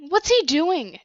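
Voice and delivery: high, loud exasperated question; furious. A narrator's reading. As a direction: demand an answer, fuming. Gina furious, surprised.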